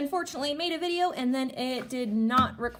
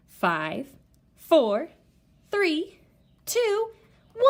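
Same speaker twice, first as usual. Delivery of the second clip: each number higher and louder; building countdown; 5 semitones higher, 5 dB louder, much slower than usual. Shown as a demonstration. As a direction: build the tension to launch. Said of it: "let's do a countdown".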